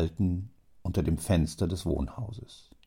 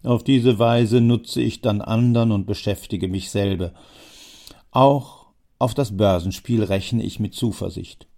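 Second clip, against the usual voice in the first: put-on a louder, pushy old man; old man pressing, louder; old Jammer, insistent; 6 dB louder than usual. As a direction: lean in and press your case as the old schemer. Jammer boasts.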